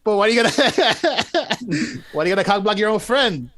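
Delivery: high, laughing through the question; can't stop laughing while asking; laughing disbelief. Real, not acted. Laughing incredulous question to friend.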